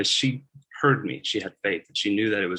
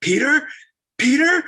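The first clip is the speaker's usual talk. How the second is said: high, loud excited cry of his name; excited squeal; 7 dB louder than usual. Acted. Servant girl Rhoda hearing Peter's voice, thrilled high cry. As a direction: squeal with joy at the door. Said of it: "she gets so excited".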